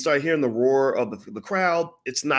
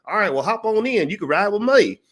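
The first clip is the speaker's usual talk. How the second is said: friendly old Southern man, voiced much higher; a folksy golf-cart driver offering a lift; old Kentucky man; 10 semitones higher, 4 dB louder than usual.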